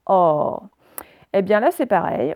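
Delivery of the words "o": "O" as a long open /ɔ/, lower in pitch. Shown as a demonstration. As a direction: open the mouth wide on "O", as in porte. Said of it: "et le O ouvert". (« O » ouvert, comme dans porte)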